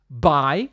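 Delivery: "Buy" com ditongo "ai" claro; pronúncia correta. Shown as a demonstration. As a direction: diz "Buy" como falante nativo, bai. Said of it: "você aprende a falar corretamente". ("Buy" pronunciado certo, como "bai")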